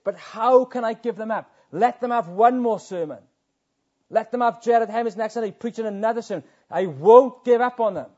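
high, loud, insistent pleading; God refusing to let go, rising to a cry; fervent divine plea. Acted. God insisting he won't give up on them, high loud plea.

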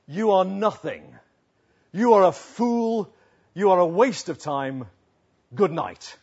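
loud, slow, emphatic put-down; emphatic mock condemnation, stopping after each sentence; scornful fan's rant. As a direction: deliver each insult as a separate loud blow. Acts out angry fan's message.

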